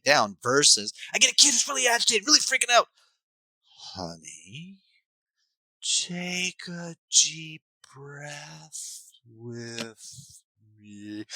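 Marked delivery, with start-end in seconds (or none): 1.1-2.9 much higher, louder freaking-out voice; agitated kid. Retold or imitated. Coach imitating an agitated child, high frantic voice. 3.9-11.2 very soft, very slow soothing voice; mismatched calm. Shown as a demonstration. Parent soothing a frantic kid, soft slow mismatch. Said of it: "There's such a mismatch between those two".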